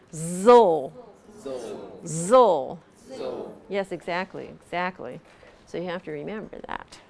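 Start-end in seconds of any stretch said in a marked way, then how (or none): 0.1-2.8 Zl with tongue behind top teeth; zl sound demo. Shown as a demonstration. Teacher modelling Zl sound for students. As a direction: model Zl for the class. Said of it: "tongue right behind the top of your teeth".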